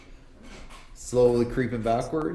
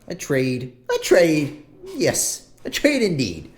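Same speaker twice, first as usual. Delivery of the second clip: put-on high, musing old shop woman; shopkeeper woman; 8 semitones higher than usual. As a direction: ponder the order in a high, fussy voice. GM voicing the shopkeeper.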